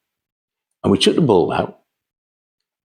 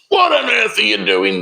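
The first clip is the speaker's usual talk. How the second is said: shocked shout. Retold or imitated. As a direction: yell it like a horrified buyer. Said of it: "they said".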